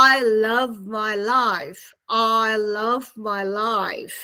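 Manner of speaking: very slow, low, stretched I vowels; I love my life with long gliding I; I sound demonstration. Shown as a demonstration. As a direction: draw out I, my and life. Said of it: "so this is I sound".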